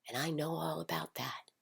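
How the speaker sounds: hushed confiding whisper; a whispered aside to the listener. Real, not acted. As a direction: drop to a secret whisper.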